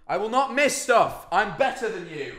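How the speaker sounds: high, drawn-out petulant bragging; squeaky, overblown self-assurance; mock boasting. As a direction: insist slowly with comic superiority.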